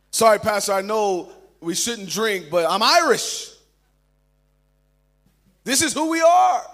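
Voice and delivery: loud, high-pitched defensive excuse; hammed-up indignant self-defence; mock protest. Voicing Irish churchgoer protesting to pastor.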